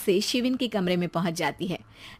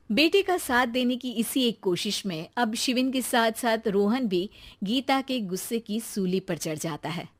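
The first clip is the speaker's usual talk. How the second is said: ordinary delivery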